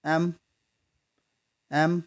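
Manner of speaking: एम repeated in isolation, opening on "e"; spaced-out एम to expose the first vowel sound; sound demonstration. Shown as a demonstration. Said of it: "ऐसे आ रही है ना".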